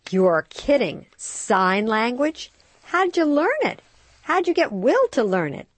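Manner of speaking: high, loud astonished questions; stunned friend grilling the other mom, high and loud; amazed. Acted. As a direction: pile on the questions in disbelief.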